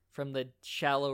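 ordinary delivery

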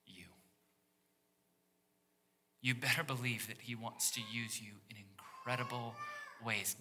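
usual speaking voice

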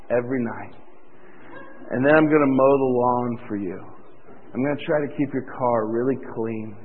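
a lightly voiced groom droning through his vows; dull groom's vows. Groom reading his chore-list vows to Linda, low and deadpan. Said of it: "And their vows are".

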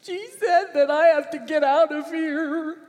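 put-on tattling little boy. Six-year-old tattling to his aunt about his sister, high and upset. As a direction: complain up high like a hurt little kid telling on his sister.

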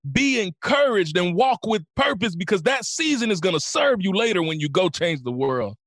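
high, driving rally speech; impassioned motivational preaching. Real, not acted. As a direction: urge on listeners who are in a fight.

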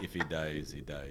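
low, quiet movie-villain impression; mock-Russian boxer, deep and cold; Ivan Drago. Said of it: "uh, Ivan Drago".

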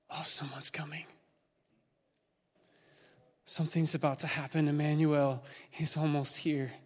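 hushed, secretive stage whisper; whispered prophecy. Acted. Prophets whispering that Emmanuel is almost here, whispered. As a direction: whisper news of someone's coming. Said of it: "the prophets begin to whisper".

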